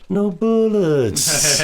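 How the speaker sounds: drawn-out, high, very slow line through a laugh; mock villain line, laughing. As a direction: stretch it out slowly while laughing, voice pushed up high. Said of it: "he's like".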